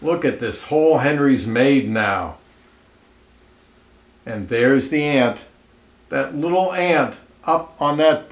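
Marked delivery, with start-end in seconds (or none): none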